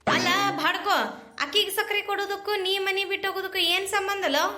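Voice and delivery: big sister scolding her brother over the sugar. Acted. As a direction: tell off the silly brother.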